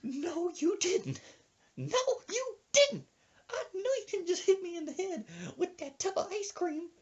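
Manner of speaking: put-on high old-lady voice, scandalized; outraged old woman. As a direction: squeal with mock outrage. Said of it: "And she goes".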